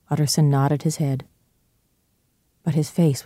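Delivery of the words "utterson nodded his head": plain reading voice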